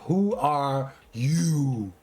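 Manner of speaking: very slow, stretched-out character voice; slow-motion mock questioning; imitated aloof caterpillar. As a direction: draw out each word like a languid caterpillar. Imitates the unhelpful caterpillar's only line.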